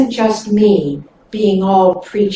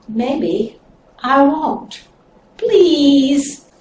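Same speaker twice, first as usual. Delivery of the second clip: pitiful; 4 semitones higher, much slower than usual. Acted. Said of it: "sort of pitiful-like".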